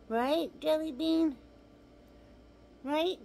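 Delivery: high, cooing voice, speaking for the dog; pet talk. Real, not acted. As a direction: coax the dog to wave goodbye. Makes the dog say goodbye.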